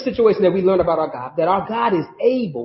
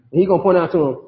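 ordinary delivery

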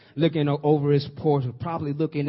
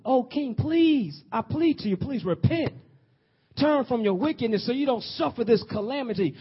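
high, begging plea to the king; pleading Daniel; 8 semitones higher than usual. Acted. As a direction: beg him to turn back. Daniel begs king to repent.

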